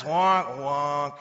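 mock sad-trombone wah-wah; sad trombone wonk. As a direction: honk out a comic letdown sound. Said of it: "Like Debbie Downer moment".

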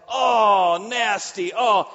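loud, high disgusted yell; disgusted shout. Retold or imitated. Owner sees vomit on sidewalk, loud disgusted shout. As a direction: recoil and shout at the mess.

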